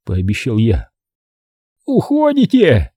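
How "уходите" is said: с радостью. A narrator's reading. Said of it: "с нескрываемой радостью спросила Мальди".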